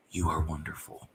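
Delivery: low, softly spoken tribute; soft dying words. Retold or imitated. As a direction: gently act out tender last words to a wife. Dying man's words to his wife.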